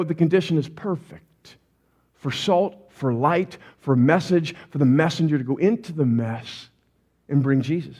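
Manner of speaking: low, measured, composed voice; calm assurance. Acted. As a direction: settle it down with quiet confidence. Calm alternative to freaking out.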